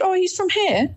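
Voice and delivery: very high, sing-song innocent reply; innocent chirp. Retold or imitated. Daughter innocently tells her dad where her boyfriend is from, high and chirpy. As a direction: chirp it out naively. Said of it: "And I said".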